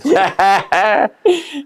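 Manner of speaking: high burst of laughter; laughing. Real, not acted. Laughing at pillow joke.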